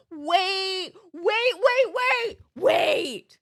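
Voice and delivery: high, loud, urgent "wait"; excited shout. Real, not acted. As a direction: stop everyone, it's a brilliant idea. Sudden gasp at an idea.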